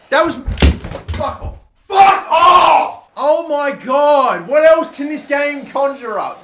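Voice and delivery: very loud high slow outburst; furious scream. Real, not acted. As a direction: explode at the screen. Conceding again, furious.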